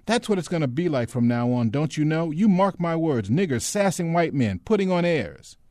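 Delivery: high, loud, ranting complaint; worked-up soldier's indignant tirade. A narrator's reading. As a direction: rail at your friend in outrage, climbing as you go. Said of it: "voice had risen yet higher in indignation".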